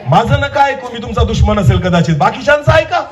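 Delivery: high, pleading preacher's cry; impassioned preaching. Real, not acted. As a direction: plead with the congregation at high pitch. Preaches at full force. (कळकळीने चढ्या आवाजात विनवणी)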